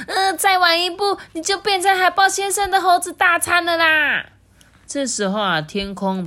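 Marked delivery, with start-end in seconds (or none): 0.1-4.3 high, loud, breathless warning; panting alarm. A narrator's reading. Penguin fleeing the giant seal, out of breath. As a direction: cry out urgently as if winded from running. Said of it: "他气喘吁吁地说".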